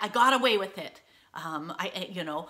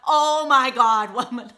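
incredulous friend; 4 semitones higher, 9 dB louder than usual. Retold or imitated. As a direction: drag it out, shocked and amused. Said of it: "she said".

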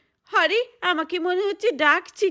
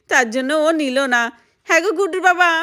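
ordinary delivery

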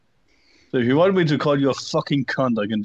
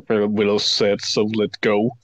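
ordinary delivery

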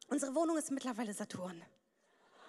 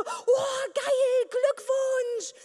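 much higher, louder squealing excitement; gushing friends; 9 semitones higher, 6 dB louder, much slower than usual. Pretend. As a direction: hype her up. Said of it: "würden dann alle schreiben so".

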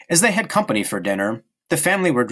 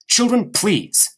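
slow, higher exasperated plea; weary scolding; 9 semitones higher, much slower than usual. Acted. Mother halting her children's bickering, slow and exasperated. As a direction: drag it out to stop the squabble. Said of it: "said Mother".

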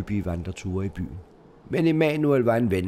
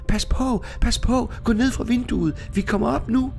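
much higher, louder frightened shout; shouting in alarm; 13 semitones higher, 4 dB louder than usual. Acted. Frightened girls shout up at the widow, alarmed shouting. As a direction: yell as loud as you can in panic. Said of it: "råbte så højt de kunne".

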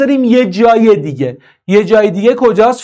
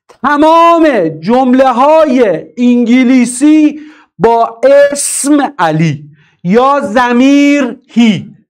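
slow emphatic rule; 4 semitones higher, much slower than usual. Shown as a demonstration. Teacher slowly stressing a grammar rule to the class. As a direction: lay down the rule slowly so it sticks. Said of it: "teacher stresses a key rule".

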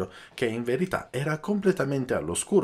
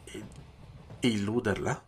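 stammering; 7 dB quieter than usual. A narrator's reading. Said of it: "balbettò".

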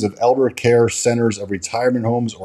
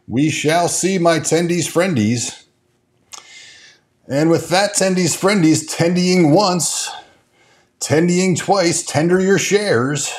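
high, slow, auctioneer-style sing-song; teasing singsong farewell to the fans; sing-song sign-off; 6 semitones higher, slower than usual. Pretend. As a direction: croon it out like a playful auctioneer. Playful sign-off to viewers.

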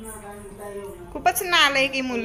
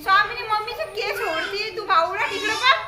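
excited high calling; 8 semitones higher than usual. Real, not acted. Baby pulling grandma's hair.

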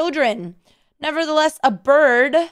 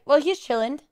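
ordinary delivery

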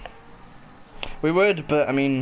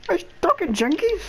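high exasperated; 12 semitones higher than usual. Real, not acted. Insisting loudly on the word.

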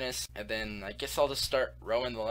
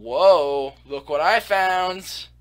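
excited; 6 semitones higher, 12 dB louder, much slower than usual. Real, not acted. Discovers a ravine.

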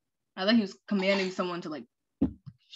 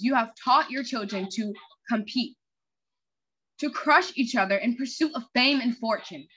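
villain's broadcast; 3 dB louder than usual. Acted. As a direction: threaten the whole city from the screen. Reads the hacker villain's broadcast.

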